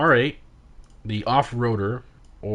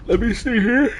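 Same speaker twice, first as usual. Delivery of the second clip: yawning; 11 semitones higher, 6 dB louder than usual. Real, not acted. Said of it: "sorry for the yawning".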